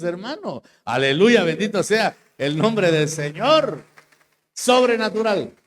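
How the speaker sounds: loud, high, slow praise cry; full-force preaching. Acted. Preacher praising the Lord before the congregation, loud and high. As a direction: lift the room with a shout of praise.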